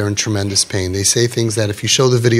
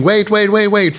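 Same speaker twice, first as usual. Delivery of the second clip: retold calling out; 9 semitones higher than usual. Rabbi calling after a fleeing guest, urgent retold shout. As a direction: imitate someone calling out urgently, pitched high. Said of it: "He was screaming".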